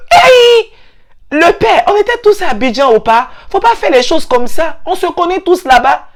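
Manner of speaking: high, laughing hey then pleading scold; laughing outraged rebuke. Retold or imitated. The teller protesting to the returnee that they all know each other.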